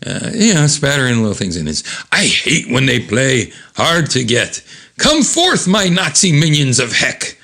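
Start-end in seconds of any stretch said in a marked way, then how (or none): 2.1-7.4 theatrical, higher-pitched villain summoning his minions; villain's incantation. Acted. Omega Man raising his hands to summon minions, grand incantation. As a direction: declaim the nonsense words like a spell. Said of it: "That's his, uh, incantation".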